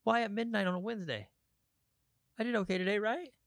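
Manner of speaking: high, pleading plea to God, retold; desperate prayer. Alone in bed at midnight, pleading upward in a high voice. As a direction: beg God to stop the hurting. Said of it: "You're just like".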